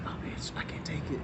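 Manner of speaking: whispered, half choking. Retold or imitated. Friend whispering she can't stand the smell. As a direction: hiss it under your breath.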